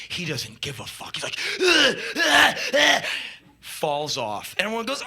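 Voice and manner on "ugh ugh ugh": higher, louder "ugh" grunts; rhythmic effortful grunting; imitated thrusting grunts. Iggy doesn't care, keeps thrusting, loud grunts.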